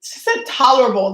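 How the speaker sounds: ordinary talk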